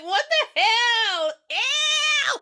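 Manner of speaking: high, loud laughing shout of disgust; disgusted kids shouting. Retold or imitated. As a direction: yell it like grossed-out kids, high and slow. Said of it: "The kids are like".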